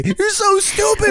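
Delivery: put-on high old-Hollywood dramatic voice; Titanic movie voice. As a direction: ham it up like a Titanic lover. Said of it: "It can go either way".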